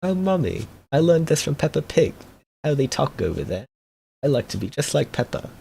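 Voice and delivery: put-on high, prim British kid voice; child imitating a British cartoon pig; mock British child. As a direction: put on a sweet little British accent like Peppa. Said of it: "your child's just talking like this".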